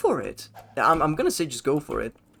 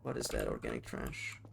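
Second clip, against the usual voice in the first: much lower, softer robot sneering at organic trash; mock robot voice; 13 semitones lower, 14 dB quieter than usual. Streamer voicing a robot for chat, low and disdainful. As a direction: play a cold machine scoffing at flesh.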